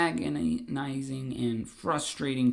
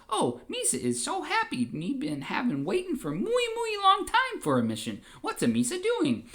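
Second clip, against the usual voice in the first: high excited Gungan voice; Jar Jar; 13 semitones higher than usual. Acted. Jar Jar thrilled at Palpatine's mission, high goofy voice. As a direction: squeal with goofy delight. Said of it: "And Jar Jar says".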